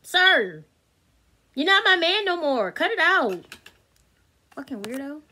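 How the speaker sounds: high and loud, fed-up scolding; exasperated telling-off. Retold or imitated. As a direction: put him in his place.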